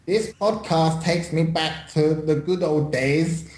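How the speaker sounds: high-pitched street-slang accent, hammed up; comic high-voiced street-slang accent; put-on street-slang accent. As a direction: read it in a jokey "innit, mate" accent. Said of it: "Do it in your what's-it-called accent".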